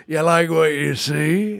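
drawn-out, oily sales pitch; slow sly salesman. Pretend. Teeth dealer tempting a buyer, sly and slow. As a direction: lean in and tempt slowly.

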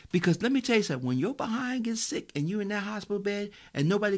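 high, loud preacher's whoop; fervent sermon build with sung prayer; preaching at full force. Real, not acted. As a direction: pour it out over the congregation.

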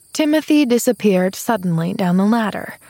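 ordinary delivery, nothing marked

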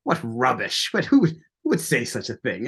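amateur mock-British gentleman; terrible British accent. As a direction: do an indignant British officer with a bad accent. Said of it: "my terrible British accent here".

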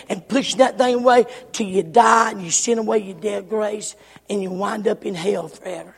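ordinary talk, nothing marked

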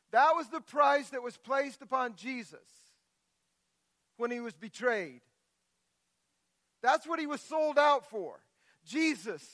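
ordinary delivery

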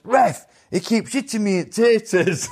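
high, slow, laughing call to the ref; mock loud protest. Boxer retelling his complaint to the ref, laughing. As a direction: act out shouting to the referee, half laughing. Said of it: "I said it very loud".

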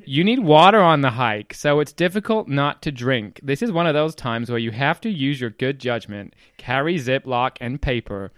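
put-on very loud, declaiming American accent; hammed-up American accent, slow and emphatic. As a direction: read it out as a booming American reviewer. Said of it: "Do the accent".

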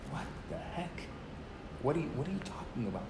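hushed retold bewilderment; soft, halting disbelief, acting out the stranger; dumbfounded. Stranger stares at the student, imitated dumbfounded muttering. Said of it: "like, dumbfounded at me".

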